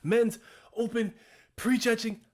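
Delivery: high, loud, bursting with excitement; can barely contain the thrill, laughing; excited. Real, not acted. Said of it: "I am so excited".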